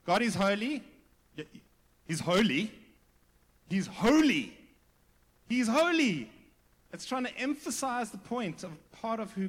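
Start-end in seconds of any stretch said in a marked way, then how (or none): 0.0-6.3 loud, building stress on HOLY; emphatic repetition. Shown as a demonstration. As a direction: hammer the word HOLY louder every time, pausing between repeats. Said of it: "to make a point and to emphasize".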